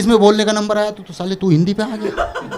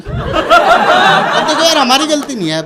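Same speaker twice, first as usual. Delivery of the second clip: mock defensive plea; 10 semitones higher, 5 dB louder, much slower than usual. Real, not acted.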